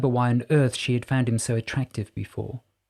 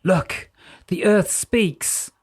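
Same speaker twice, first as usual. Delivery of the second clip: awed excitement; 7 semitones higher, much slower than usual. A narrator's reading. Morven thrilled by the light.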